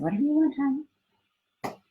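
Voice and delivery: mock placating husband.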